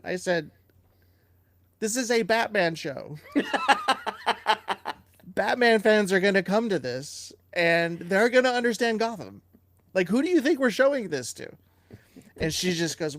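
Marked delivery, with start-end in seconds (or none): 1.8-11.5 indignant. Retold or imitated. Retold argument with executive.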